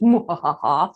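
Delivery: low, stagey muahaha cackle; evil villain laugh. Acted. Disney villain gloating over the apple, evil cackle. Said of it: "I'm an evil Disney villain".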